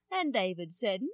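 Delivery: ordinary delivery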